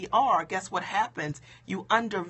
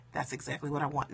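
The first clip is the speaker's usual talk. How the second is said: low, quiet mock deflation; mock deflated; 6 semitones lower, 7 dB quieter, faster than usual. Coach voicing someone who says "I'm fat", mock deflated. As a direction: act out half-hearted agreement under your breath. Acting out failing to back it up.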